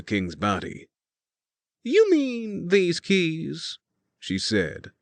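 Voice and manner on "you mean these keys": teasing Elise. A narrator's reading. Elise teases, dangling keys.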